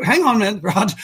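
normal talking voice

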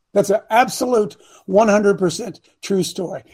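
usual speaking voice

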